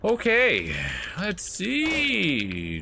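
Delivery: high, stretched-out words; drawn-out high call. Real, not acted. Player talking to the game, loud drawn-out high call.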